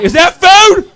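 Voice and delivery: high dopey excited squeal; stupid younger self. Retold or imitated. Dim kid spotting candy on the counter, high eager squeal. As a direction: shoot up high in dumb delight. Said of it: "my stupid ass was like".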